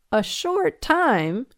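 high, slow amused echo; drawn-out, grinning disbelief. Acted. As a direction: repeat it with a teasing grin. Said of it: "he remarked with a grin".

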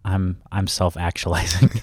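usual speaking voice

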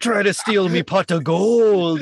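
hammed-up Irish leprechaun voice; slow, put-on Irish brogue. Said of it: "pretending to do that leprechaun bit".

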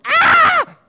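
high, loud scream of fright at a snake; startled scream. Acted. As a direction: shriek like you've just seen a snake. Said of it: "Like how you'd run from a snake".

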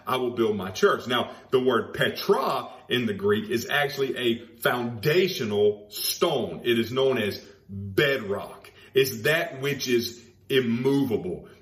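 normal talking voice

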